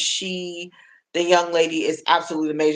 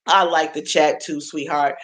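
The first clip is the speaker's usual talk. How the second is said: ordinary talk, nothing marked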